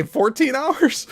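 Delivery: high, slow, drawn-out disbelief; mock incredulous question. Mocking his additional seven hours, incredulous. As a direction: stretch it upward, mocking his sums.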